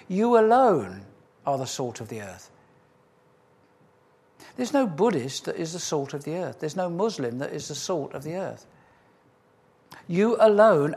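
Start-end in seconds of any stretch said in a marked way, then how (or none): 0.1-1.0 ALONE hit high and loud; emphatic. Real, not acted. Preacher insisting to his congregation, with heavy stress on alone. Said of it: "It's emphatic". 10.1-11.0 alone shouted high and loud; emphatic shout. Real, not acted. Preacher driving the point home to believers, shouting.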